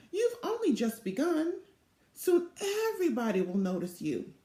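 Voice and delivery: high, sweet motherly coo; a mother soothing her little girl. Acted. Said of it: "cooed Velma's mother".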